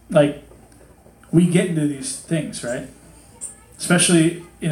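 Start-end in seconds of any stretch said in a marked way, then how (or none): none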